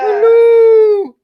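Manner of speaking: loud high call. Real, not acted. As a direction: shout hello to be heard. Calling to a lagging friend.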